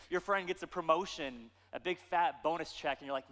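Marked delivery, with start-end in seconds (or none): none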